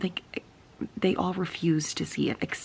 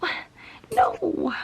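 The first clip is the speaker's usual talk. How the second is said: incredulous retort; 13 semitones higher than usual. Retold or imitated. Her own disbelieving reaction.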